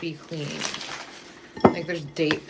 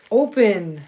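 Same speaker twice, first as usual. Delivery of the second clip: a louder, sharp order to the bag; barked at a stuck bag; frustrated command; 7 dB louder than usual. Real, not acted. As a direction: force it open with your voice. Fighting to open a bag.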